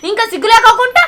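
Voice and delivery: loud, bitter scolding; angry scolding. Acted. Wife scolding her drunk husband over his lies, loud. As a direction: scold him hard and fast.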